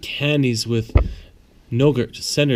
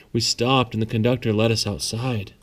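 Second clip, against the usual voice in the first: ordinary delivery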